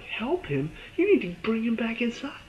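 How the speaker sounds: high incredulous yell at the screen; exasperated protest. Retold or imitated. Fan arguing with Michonne on screen, high incredulous protest. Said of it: "I'm like".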